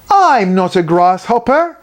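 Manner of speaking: put-on tiny girl correcting Muckle Mackie, shouting; shouted. A narrator's reading. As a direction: protest the insult. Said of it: "shouted the teeny-weeny girl".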